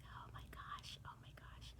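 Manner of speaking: breathy whispered exasperation, under her breath; whisper to herself. Real, not acted. Vlogger stuck for facts whispers to herself in exasperation. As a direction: whisper it to yourself, stuck for ideas.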